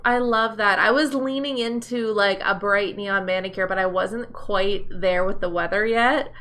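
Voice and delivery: normal talking voice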